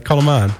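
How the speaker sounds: "kalm" uitgesproken als "kallem"; lokale uitspraak. Shown as a demonstration. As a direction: zeg "kalm aan" met een extra e: "kallem aan". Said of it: "Ja, dat zeggen we hier". ("kalm" als "kallem", zoals ze hier zeggen)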